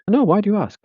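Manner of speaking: high playacted stranger voice; stranger in a bar. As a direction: pitch it way up as the curious stranger. Acted stranger in bar dialogue.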